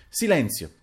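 sharp, high order; high-pitched imperious command; commanding call for silence. A narrator's reading. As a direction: demand silence with authority. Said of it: "intimò a questo punto".

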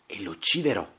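restrained threat through clenched teeth. A narrator's reading. Duellist vows to kill that man, teeth clenched. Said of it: "mormorò coi denti stretti".

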